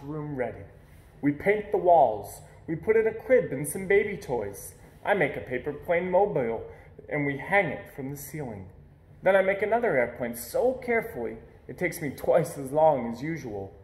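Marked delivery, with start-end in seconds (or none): none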